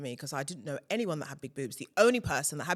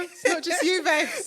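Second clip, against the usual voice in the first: higher, louder sassy "babes"; playful sassy reassurance; 13 semitones higher, 7 dB louder, slower than usual. Real, not acted. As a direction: tease her friend warmly, with sass. Playful agreement about tube runway.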